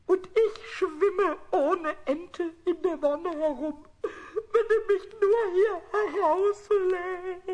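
slow, drawn-out plea; slow laughing plea; pitiful bargaining. Acted. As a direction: drag it out pitifully.

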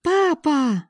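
put-on high little girl's cry of papa; a small daughter calling her father, high and bright; child's voice. A narrator's reading. Daughter runs in calling her father, high child's voice. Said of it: "раздался детский голосок".

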